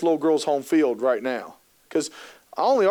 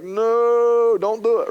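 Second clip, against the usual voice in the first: a higher, louder mock plea across the aisle; calling out; 5 semitones higher, 5 dB louder, much slower than usual. Therapist calling to the mom from the aisle end, loud plea. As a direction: shout a warning from far off.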